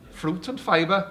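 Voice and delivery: slow Welsh accent, high rising. Shown as a demonstration. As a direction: drawl it out Welsh-style. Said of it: "it's like fruit and fibre".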